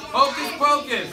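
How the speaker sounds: loud rhythmic attention call. Real, not acted. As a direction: boom it out to grab the class. Teacher's attention call to class.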